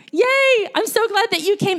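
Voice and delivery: high, loud "yay" easing off; delighted cheer. Real, not acted. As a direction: cheer for the people who came. Cheers those who attended festival.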